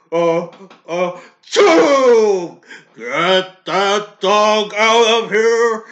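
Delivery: mock sneeze exploding into a drawn-out shout; huge fake sneeze, then holler it slowly; loud, hammed-up allergic outburst; Mister Socrates. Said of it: "I can do a good impression of this".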